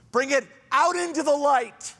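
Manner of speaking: rising, loud, urgent preaching; full-force sermon climax, high and loud. Acted. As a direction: drive it home to the congregation, voice climbing high.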